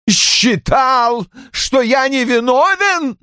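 put-on a bitter, outraged old man echoing her; roared. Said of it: "взревел Рой". (взревел с горькой насмешкой)

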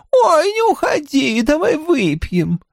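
shrill camp pantomime dame; high, squeaky mimicry of a tipsy woman; squeaky mocking voice. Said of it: "[name] заговорил писклявым голосом, передразнивая Гейл". (писклявым голосом, передразнивая)

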